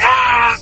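a short imitated rasping yell; mock hoarse scream. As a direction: rasp out a mild possessed shout. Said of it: "hacer la voz muy ronca".

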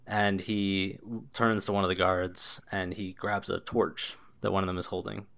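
ordinary talk

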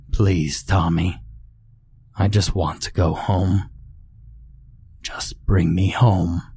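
a hint of an unearthly child ghost pleading; light raspy ghost voice. A narrator's reading. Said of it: "The voice was light and raspy".

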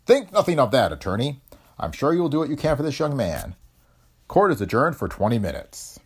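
a judge speaking from the bench, measured and formal; stern judge. A narrator's reading. Judge addressing the red-headed defendant, formal and stern.

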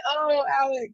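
high-pitched retold "oh" of recognition; delighted recognition. Retells reaction to recognizing Alex.

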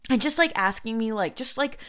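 ordinary talk

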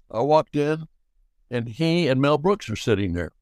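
usual speaking voice

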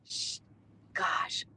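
soft unvoiced gosh, moved; a whispered gosh, overcome; choked-up whisper. Real, not acted. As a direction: breathe it out, near tears. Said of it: "She brings tears to my eyes".

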